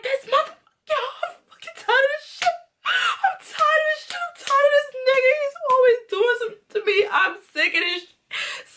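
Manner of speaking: very high, fast squealed rant; hammed-up tearful shriek of fed-up fury; furious. Woman banging around the work kitchen, furious high squeal. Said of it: "I'm furious".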